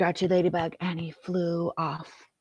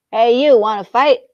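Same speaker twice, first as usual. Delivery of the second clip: grouchy shout; 4 semitones higher, 12 dB louder than usual. Acted. Grouchy ladybug picks a fight.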